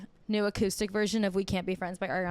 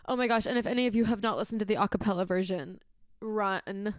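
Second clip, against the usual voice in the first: normal talking voice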